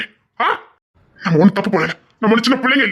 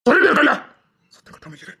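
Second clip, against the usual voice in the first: ordinary talk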